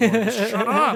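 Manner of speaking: loud, high exasperated outburst; mock angry yell. Fed-up fan to a heckler in a comedy show, exasperated yell.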